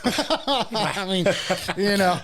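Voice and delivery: laughing through the words. Real, not acted. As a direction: chuckle while you talk. Laughs at own joke.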